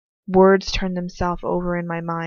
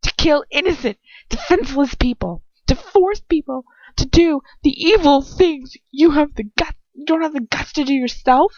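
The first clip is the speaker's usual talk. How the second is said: loud, higher, furious challenge; angry accusation; 10 semitones higher, 6 dB louder than usual. Acted. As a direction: hurl the words like knives. Narrator accuses the villain.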